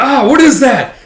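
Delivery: high, loud alarmed cry; startled fright. Acted. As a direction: gasp it out in shock. Soldiers see the dragon.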